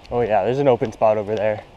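ordinary talk

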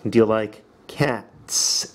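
very slow, each word laid out clearly; slow teacher modelling of "Do you like"; slow emphasized questions. Shown as a demonstration. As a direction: spell out every word for the class. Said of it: "Emphasize it".

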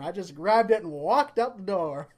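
ordinary talk, nothing marked

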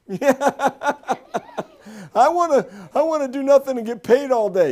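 put-on high, whining grown man like a toddler; mocking a lazy worker as a whiny baby. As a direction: whine it like a big baby. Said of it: "Forty-year-old babies".

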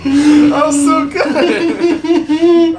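laughing through the words, slow and high; words stretched out by real laughter. Real, not acted. Laughing after own joke.

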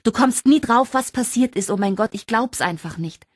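high and loud, easing off by the end; breathless excitement settling a little; excited shriek. A narrator's reading. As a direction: gush the news, barely able to hold it in. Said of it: "kreischte sie".

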